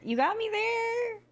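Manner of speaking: high, slow teasing sing-song; playful sing-song. Pretend. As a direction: draw it out high, mock-conceding. Repeating the concession playfully.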